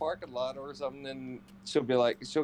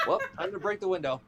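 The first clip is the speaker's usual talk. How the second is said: laughing threat; 6 semitones higher, faster than usual. Retold or imitated. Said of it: "I'm like".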